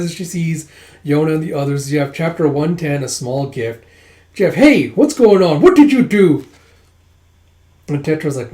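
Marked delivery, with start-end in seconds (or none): 4.6-6.5 acted outraged yelp; indignant outburst. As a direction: demand an explanation. Acted indignant surprise at Tetra.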